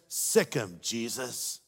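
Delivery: low, menacing parrot order; a lightly voiced parrot sicking the dog; parrot command. Parrot ordering the Doberman, low and menacing. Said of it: "the parrot says".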